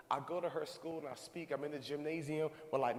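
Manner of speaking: ordinary delivery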